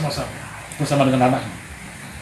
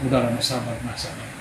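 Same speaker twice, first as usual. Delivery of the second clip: soothing mother; 5 semitones lower, 6 dB quieter than usual. Retold or imitated. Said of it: "Mamanya bilang".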